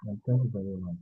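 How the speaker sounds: faint, far-off voice; quiet, distant mic; barely audible. Real, not acted. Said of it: "can you increase your volume a bit?".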